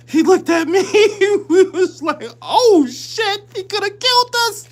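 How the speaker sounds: high, laughing through the words; can barely get it out for laughing; laughing hard. Real, not acted. Telling friends about the fight, laughing hard. Laughing while retelling fear.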